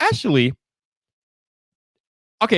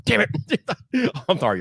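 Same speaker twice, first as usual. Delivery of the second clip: usual speaking voice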